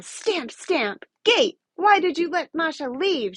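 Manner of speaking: Baba Yaga snarls at her gate for letting Masha go; snarling witch. Acted. Angry witch snapping out a demand. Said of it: "she snarled".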